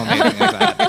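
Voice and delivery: high, laughing delivery. Real, not acted. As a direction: squeal it through a laugh.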